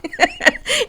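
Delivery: a squeal of laughter at her own joke; high burst of laughter. Real, not acted. Cracking up after the mock accent to viewers.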